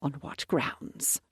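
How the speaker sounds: hushed question. Acted. Viscount questions accusation, hushed.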